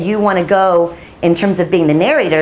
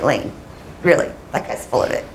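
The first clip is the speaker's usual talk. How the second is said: mock editor; 7 semitones lower, 7 dB quieter than usual. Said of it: "an editor like Mike who'll be like".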